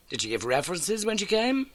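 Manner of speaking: a crisp, brisk detective; Holmes. A narrator's reading. Holmes introduces the landlady to Watson, brisk.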